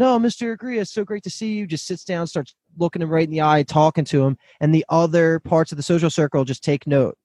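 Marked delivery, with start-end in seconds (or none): 0.0-1.7 high, breezy mock welcome; lifted pitch, softening toward the end; imitated joyful greeting. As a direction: imitate someone delighted to greet a guest. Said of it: "He was just joyful coming".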